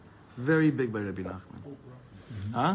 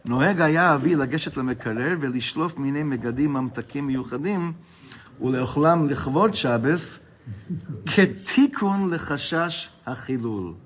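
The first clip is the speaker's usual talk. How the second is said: quick, louder sing-song reading of the Hebrew; text reading; 3 semitones higher, 7 dB louder, faster than usual. Acted. Teacher reading the book aloud to his class, fast and loud. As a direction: rattle off the printed text like a study-hall reader.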